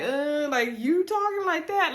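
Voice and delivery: can't believe he talks like that; imitating a shocked wife, pitched high; shocked disbelief. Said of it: "she was like".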